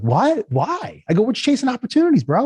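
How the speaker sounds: high-pitched, defensive surprise; baffled protest. Retold or imitated. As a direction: re-enact being caught off guard. Retold bewildered reply to CTO.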